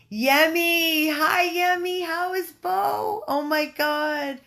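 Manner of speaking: high, loud, drawn-out delighted squeal of hello; real thrilled high-pitched shout; excited greeting. Real, not acted. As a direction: greet a dear friend with gushing joy.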